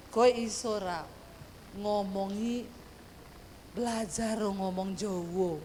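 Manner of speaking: low, slow, measured request; calm Javanese husband. Retold or imitated. Husband asking wife to learn Javanese, low slow coaxing.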